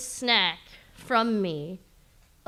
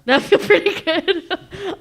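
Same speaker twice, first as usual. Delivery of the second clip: high, slow teasing repeat; teasing echo; 7 semitones higher, 7 dB louder, slower than usual. Acted. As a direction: echo it back to tease.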